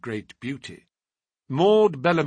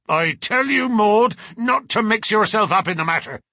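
much higher and louder, a furious shout; angry father's cry; 9 semitones higher, 7 dB louder than usual. Acted. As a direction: bellow it at the daughter to silence her. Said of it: "cried her father angrily".

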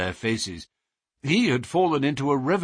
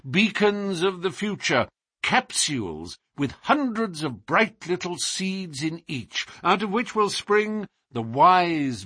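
higher-pitched, rhapsodic detective; visionary detective; 4 semitones higher than usual. Acted. Detective pointing out board schools to his friend, inspired. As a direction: build with admiring conviction, easing down at the end.

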